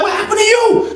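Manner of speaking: high startled lady voice; shocked guard lady. Retold or imitated. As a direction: cry out in alarm. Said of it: "The lady's like".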